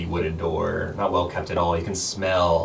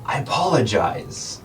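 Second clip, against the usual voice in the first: higher, louder stammering apology; flustered Pat; 6 semitones higher, 4 dB louder than usual. Acted. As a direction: stumble over the name in panic.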